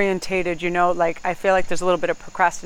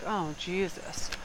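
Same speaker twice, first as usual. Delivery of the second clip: exasperated sigh; 9 dB quieter, much slower than usual. Retold or imitated. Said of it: "You're like".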